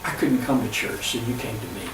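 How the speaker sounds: softly retold tearful gratitude; understated, moved, voice sinking. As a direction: quote her low and hushed, fading at the end. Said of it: "with tears in her eyes going".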